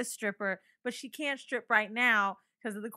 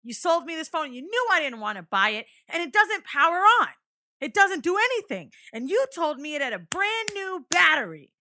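high, loud furious complaint; angry old customer; 8 semitones higher, 8 dB louder than usual. Retold or imitated. An old customer yelling at the clerk, loud and angry. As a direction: rail at the clerk. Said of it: "He goes".

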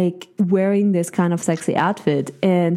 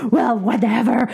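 dismissive; 3 semitones higher than usual. Retold or imitated. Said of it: "And I go".

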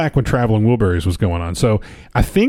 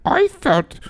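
high mock Indian accent; 13 semitones higher, much slower than usual. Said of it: "I can't do it".

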